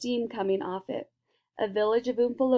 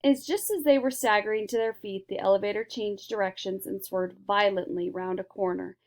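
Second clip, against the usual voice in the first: ordinary delivery, nothing marked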